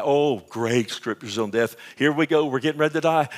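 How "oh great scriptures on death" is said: normal talking voice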